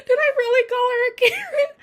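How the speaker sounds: high, laughing did-I-just-say-that; amused disbelief. Real, not acted. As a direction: catch yourself mid-laugh, squeaky and amused. Laughs at her own remark.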